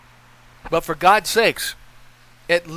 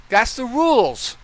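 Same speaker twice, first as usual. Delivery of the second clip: ordinary delivery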